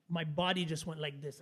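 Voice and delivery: ordinary talk, nothing marked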